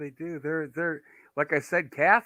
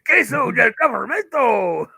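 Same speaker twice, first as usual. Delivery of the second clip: mock Spanish; 8 semitones higher, 7 dB louder than usual. Mock Spanish joke on government cheese.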